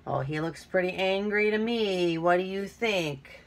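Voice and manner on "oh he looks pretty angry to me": usual speaking voice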